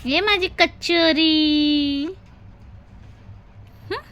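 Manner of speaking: drawn out, very slow, tender call; slow coaxing. Real, not acted. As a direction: coax her back, stretching every word. Mother coaxes sulking child. (लाडाने हळू हळू बोलावणे)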